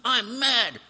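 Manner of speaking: mock child, high and loud; a sulky child shouting it out; little Johnny. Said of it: "little Johnny ... says".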